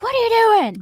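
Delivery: high, loud disbelieving shout; outraged yell. Retold or imitated. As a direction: yell it at the refs. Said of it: "but I'm like".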